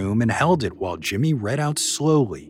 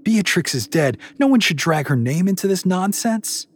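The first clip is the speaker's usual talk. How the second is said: indignant; 10 semitones higher, 5 dB louder than usual. A narrator's reading. Candace rebukes the Oracle.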